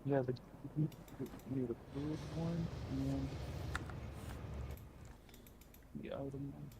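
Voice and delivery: muffled, distant mic sound. Real, not acted. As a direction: voice through a bad, muffled mic. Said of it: "it sounds like your mic's muffled".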